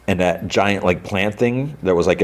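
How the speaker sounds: normal talking voice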